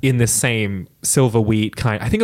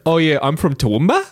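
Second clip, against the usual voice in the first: self-mocking broad Australian accent, a little higher; imitated Australian accent; 5 semitones higher than usual. Mimicking their old recorded self for laughs, broad Australian accent. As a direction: play up the Australian twang. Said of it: "I sound very different".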